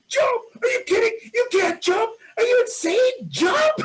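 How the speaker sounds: very high, loud panicked yelling; frantic shouting. Retold or imitated. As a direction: scream your disbelief up the cliff. Belayers yelling back in disbelief.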